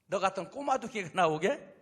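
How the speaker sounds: lightly voiced scornful sneer; Goliath sneering at the boy David; mocking giant. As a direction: scoff at the tiny challenger. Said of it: "껄껄 웃어요".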